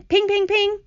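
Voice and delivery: high, ringing pings; pinging sound effect. A narrator's reading. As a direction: imitate hailstones bouncing off a shell. Imitates hailstones bouncing off shell.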